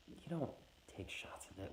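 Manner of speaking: a private muttered warning to himself; hushed aside. Retold or imitated. Whiskey-wary young man whispering to himself.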